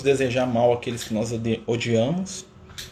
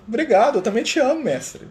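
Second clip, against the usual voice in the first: much higher, grateful reply to his master; Frei Leão agradecendo; 11 semitones higher than usual. Retold or imitated. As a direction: thank him back in a raised, eager voice. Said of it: "E o Frei Leão fica assim". (Frei Leão agradecido, voz bem mais aguda)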